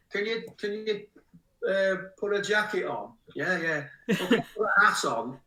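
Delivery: high-pitched mock photographer request; put-on request. Acting out requests to cover up.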